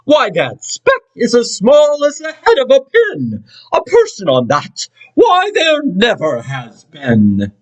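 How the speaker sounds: high, sniffy, dismissive; pooh-pooh the idea, drawling the end; scoffing kangaroo. Acted. Sour kangaroo mocking Horton's speck, high scoff.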